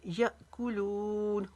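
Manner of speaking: यअकुलून with a sharp catch after य, लू drawn out; slow यअकुलून, glottal jerk then long लू; jerked hamza, long लू. Shown as a demonstration. Teacher showing hamza with a jolt, यअकुलून ending long. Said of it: "या इसको झटके के साथ पढ़ेंगे".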